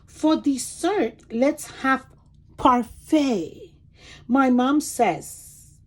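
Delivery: lively storybook read-aloud; reading to children. Acted. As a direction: read it like a picture book for small kids. Reads picture book aloud.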